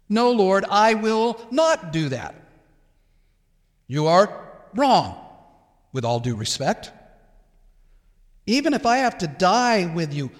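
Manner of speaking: acted vehement protest, punching the negatives; emphatic. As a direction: swear it with great emphasis, piling up the refusals. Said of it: "emphatically, with great emphasis".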